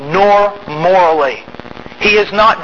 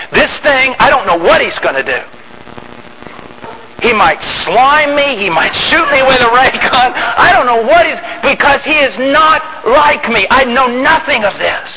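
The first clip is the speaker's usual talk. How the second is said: alarmed; 5 semitones higher than usual. Acted. Preacher acting out fear of alien.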